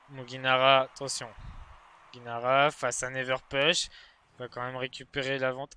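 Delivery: tense live play-by-play; esports caster. Acted. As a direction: build tension to the goal. Live Rocket League match commentary.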